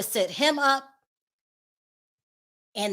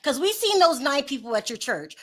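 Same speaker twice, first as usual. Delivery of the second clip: ordinary talk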